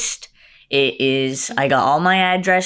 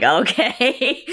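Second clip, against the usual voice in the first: laughing okay; 7 semitones higher than usual. Retold or imitated. Retold own bemused reply.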